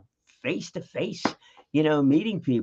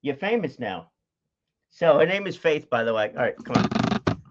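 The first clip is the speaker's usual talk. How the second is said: ordinary talk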